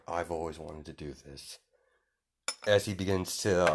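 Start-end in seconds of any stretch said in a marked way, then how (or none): 0.1-1.6 softly voiced character line; quiet, low aside. Izuku relishing the attack, quietly. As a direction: say it low, under your breath.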